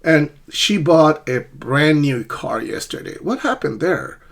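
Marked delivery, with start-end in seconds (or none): 0.5-3.2 "bought", "brand" and "yesterday" stressed; stress on key words. Shown as a demonstration. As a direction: punch "bought" and a long "brand", then "yesterday". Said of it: "the stress is on".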